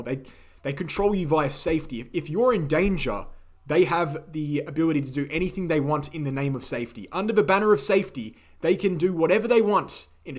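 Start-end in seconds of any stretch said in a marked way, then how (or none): none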